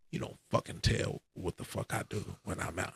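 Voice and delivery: a hint of a quietly furious brother; angry brother. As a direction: scold under your breath. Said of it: "he was like".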